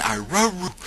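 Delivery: high dog howl shaped into words; talking dog. Retold or imitated. Dog repeating "I love you" to its owner, howling. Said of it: "the dog said it again".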